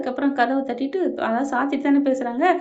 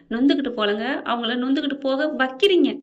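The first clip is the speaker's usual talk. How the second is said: plain reading voice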